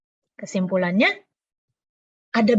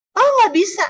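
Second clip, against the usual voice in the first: mock excuse; 8 semitones higher, 7 dB louder than usual. Mock excuse refusing God.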